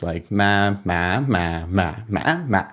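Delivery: usual speaking voice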